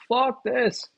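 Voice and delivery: high, loud fed-up outburst; a mock Noah throwing up his hands. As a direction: done with it all, yelled up high. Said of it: "He's like".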